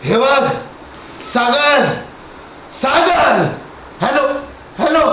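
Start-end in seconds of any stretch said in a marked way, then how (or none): none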